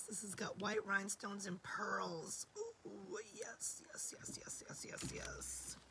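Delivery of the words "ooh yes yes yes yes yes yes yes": whispered through a lost voice; breathy whisper, voice gone. Real, not acted. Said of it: "I've lost my voice".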